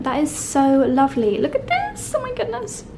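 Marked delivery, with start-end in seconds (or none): 1.4-2.8 way up high and hushed, squeaking with delight; tiny high squeal over the bath salts. Real, not acted.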